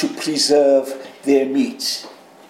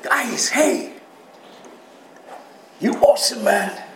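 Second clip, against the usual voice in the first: a higher, breezy buddy voice, a touch quicker; casual Jesus; 3 semitones higher than usual. Retold or imitated. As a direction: play it like a laid-back friend, lightly voiced. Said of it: "Jesus was smiling when he said that".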